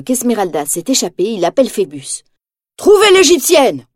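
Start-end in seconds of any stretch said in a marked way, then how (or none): 2.8-3.9 high, loud barked order; commanding shout. A narrator's reading. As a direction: bark the order at the guards. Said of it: "ordonne-t-il".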